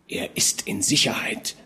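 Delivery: hushed secret whisper; a father whispering good news to his son. A narrator's reading. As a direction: breathe it in his ear. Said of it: "flüsterte er ihm zu".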